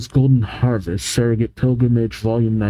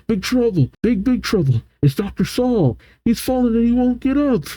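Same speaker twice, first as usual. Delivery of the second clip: panicked messenger; 10 semitones higher than usual. Acted. Villager running around with bad news, high mock panic. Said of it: "someone runs around saying".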